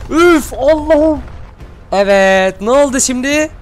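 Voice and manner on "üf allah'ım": high, drawn-out exasperated cry; exasperated groan. Real, not acted. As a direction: let out a long groaning exclamation. Exasperated at the fight. (uzun, yüksek bir sitem)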